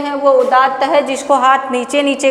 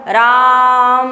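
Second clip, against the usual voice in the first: long drawn call; much slower than usual. Shown as a demonstration. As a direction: hold the name very long and sung. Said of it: "राम, राम क्या?". (बहुत लंबा खींचकर पुकारना)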